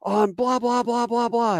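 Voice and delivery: high, loud, slow shout for fire; lieutenant calling in fire under attack, urgent shout; urgent radio call. Retold or imitated. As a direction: bark the fire mission over the radio. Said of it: "He goes".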